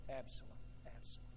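a broken near-whisper of regret; hushed crying. Acted. David grieving his son too late, hushed and broken. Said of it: "he cried".

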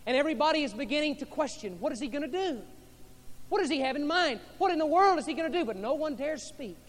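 high, loud, fast wondering; building excited preaching. Acted. Crowd wondering aloud.

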